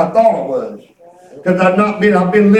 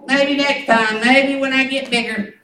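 lightly voiced little girl, slightly raised pitch; imitated six-year-old; 3 semitones higher, slower than usual. Granddaughter declining river lesson, lightly mimicked child voice. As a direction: mimic a child putting it off, drawn out. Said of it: "She said".

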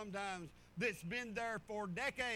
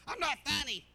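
mock wife; 5 semitones higher, 6 dB louder than usual. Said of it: "And she's".

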